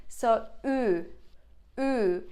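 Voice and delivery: "ü" as in equivalence, lips pursed like a duck face; Turkish ü demo. Shown as a demonstration. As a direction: round and push the lips for "ü". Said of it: "your mouth is like, like duck".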